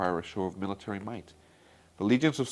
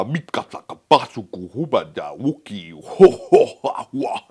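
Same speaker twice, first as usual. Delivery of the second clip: loud booming alien gibberish with a laugh; Jabba the Hutt voice; 10 dB louder than usual. Retold or imitated. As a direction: boom it out like a slimy gangster. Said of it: "I have a good Jabba the Hutt impersonation".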